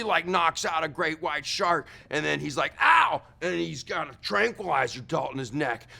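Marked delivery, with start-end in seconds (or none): 2.8-3.2 high, loud yelp of pain. Retold or imitated. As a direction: yelp like a dart just hit. Trump hit by dart.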